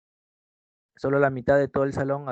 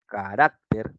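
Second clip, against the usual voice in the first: Carácter lower, leaning on RAC; stress on second-last syllable; 4 semitones lower, 3 dB louder, much slower than usual. Shown as a demonstration. Teacher repeating a grave word for students, Carácter stressed on RAC. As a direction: say Carácter in separated syllables, weight on the middle one. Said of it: "Ellas son las palabras graves".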